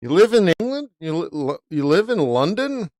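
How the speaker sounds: can't believe what he just heard; incredulous question. Retold or imitated. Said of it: "I'm like".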